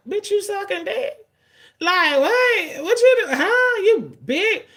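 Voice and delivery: high, loud, laughing mock questions; dirty-minded mock shock. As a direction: voice a dirty thought in squealed disbelief, laughing. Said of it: "dirty mind be like".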